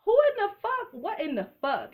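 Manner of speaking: high, squealing incredulous questions; retold disbelief. Reacting to the show's twist, high incredulous squeal. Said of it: "I was like".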